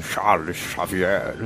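deep, slow villain voice; Magneto voice. Retold or imitated. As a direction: drag out the name like a menacing villain. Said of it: "Ele falando".

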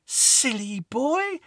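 put-on high, slow teasing child's voice, rising on not good enough; teasing little girl. A narrator's reading. Lucy mocks the boy's small pebble, high and teasing. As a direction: tease him sweetly, then dismiss the pebble. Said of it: "she said, stroking my cheek".

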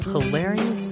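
ordinary talk, nothing marked

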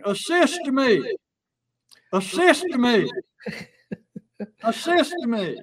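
high, drawn-out mock cry for aid; mock yelling. Stranger in a parking lot, mock yelling oddly worded plea. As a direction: call out the odd plea as if in trouble. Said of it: "somebody yelling".

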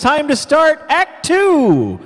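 rising hype-man announcement, high finish; high, excited emcee call; stage announcement. Real, not acted. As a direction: announce it big to the crowd. Host announces the next act.

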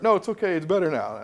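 put-on a little kid faking that nothing hurts; pretending child. Child tells the parent the splinter no longer hurts, high fake brave voice. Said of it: "they pretend it doesn't hurt".

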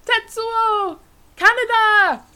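loud, high anguished yelling; Akira shouting match. Acted. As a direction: scream the names across the ruins. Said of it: "that Akira scene".

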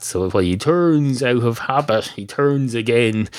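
ordinary talk, nothing marked

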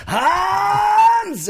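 very high mock scream of a name; imitated movie shout. Fan reenacts Die Hard fall, imitated movie shout. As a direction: cry out the name like a falling film villain. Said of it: "it's like".

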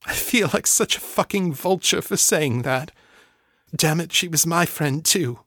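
the words thick with suppressed grief. Acted. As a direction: guilt catching in her throat. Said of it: "then added in a thick voice".

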